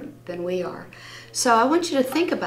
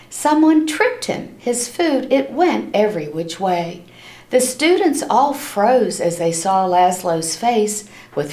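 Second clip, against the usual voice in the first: lively storybook read-aloud for a class; reading to children; 6 dB louder than usual. A narrator's reading. Teacher reading a rhyming book to her class, lively and animated. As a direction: read it like story time, bouncing along the rhymes.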